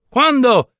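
"Quando?" molto più acuto e forte, incalzante; quando gridato. Acted. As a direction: sbottare "Quando?" ad alta voce. Ripete la domanda alzando la voce. (il viaggiatore grasso incalza il padre: "Quando?" gridato)

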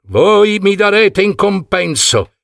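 high, demanding cry; commanding demand; Agamennone shouting. A narrator's reading. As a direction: order it like an offended king. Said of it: "gridò il re Agamennone".

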